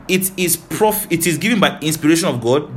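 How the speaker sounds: ordinary talk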